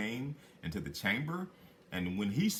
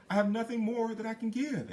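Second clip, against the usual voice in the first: a lightly voiced maiden, much higher; pleading girl, pitched up high; young maiden; 12 semitones higher, 3 dB louder than usual. Despairing maiden answers little man.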